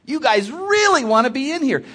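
high, loud burst of amused amazement; amused delight. Acted. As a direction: exclaim it loudly, getting a kick out of it. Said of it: "our Lord got a kick out of this".

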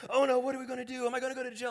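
high, mock-panicked wail; hammed-up high-pitched panic; panicked driver. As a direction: imitate someone freaking out.

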